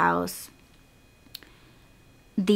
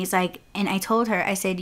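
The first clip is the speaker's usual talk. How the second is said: ordinary delivery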